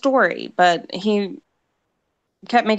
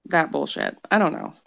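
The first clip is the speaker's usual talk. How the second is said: ordinary delivery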